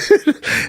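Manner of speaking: high-pitched burst of laughter; real, helpless high laugh. Real, not acted. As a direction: crack up at the memory. Laughing at the remembered boast.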